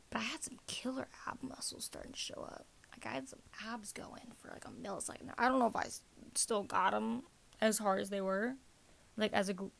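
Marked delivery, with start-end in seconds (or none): 0.1-5.3 whispering. Real, not acted. Secretive aside about abs.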